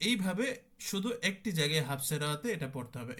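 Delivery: normal talking voice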